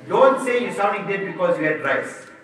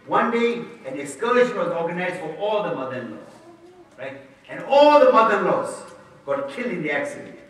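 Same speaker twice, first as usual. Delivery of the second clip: ordinary talk, nothing marked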